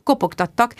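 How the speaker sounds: ordinary delivery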